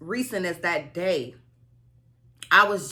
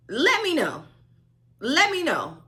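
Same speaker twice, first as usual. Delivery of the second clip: playful challenge; 8 semitones higher, 4 dB louder than usual. Real, not acted. Challenging viewers.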